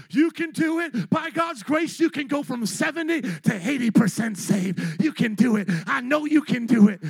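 loud hype-man shouting; whooping and hollering. Retold or imitated. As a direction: holler it like a revival preacher pumping up the crowd. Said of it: "if I started whooping and hollering".

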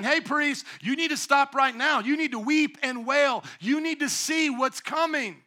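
high, loud call to the priests; urgent warning. Pretend. God warning the priests, loud and urgent. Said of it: "He's saying".

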